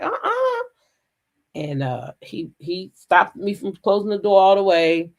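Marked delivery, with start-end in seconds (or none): 0.0-0.6 high refusal. Retold or imitated. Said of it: "and I'm like".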